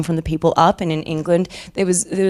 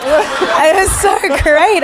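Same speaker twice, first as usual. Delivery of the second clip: giddy delight; 9 semitones higher, 4 dB louder, much slower than usual. Retold or imitated. Re-enacting delight at theatre games.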